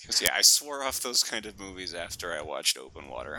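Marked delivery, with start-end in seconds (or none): none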